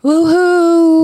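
high, loud woohoo; excited cheer. Shown as a demonstration. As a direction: belt out a proper cheer. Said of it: "try it again".

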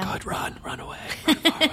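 hushed, hurried warning; mock urgent whisper. Host jokingly warning guest off the Valley, urgent whisper. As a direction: whisper it like a secret escape warning.

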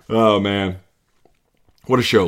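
ordinary talk

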